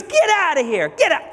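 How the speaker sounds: acted angry dismissal. Realtor throwing the buyer out, acted angry dismissal. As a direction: drive him out the door, play-acted.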